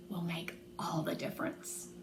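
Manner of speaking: soft whispered delivery; doctor softly grateful for the news; hushed. A narrator's reading. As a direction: breathe it out with relief. Doctor smiling, hushed relief.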